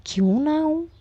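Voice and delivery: high playful tease; teasing. Acted. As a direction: challenge her with a cheeky lilt. Teasing her embarrassed spouse.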